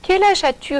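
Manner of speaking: slow it down so she understands; slowly repeated. A narrator's reading. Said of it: "répète Mireille très lentement".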